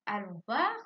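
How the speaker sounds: usual speaking voice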